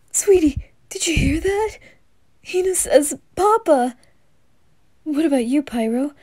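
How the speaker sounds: thrilled dad breaking his hush; excited dad. Acted. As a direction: burst with joy at her first word. Dad overjoyed at first word.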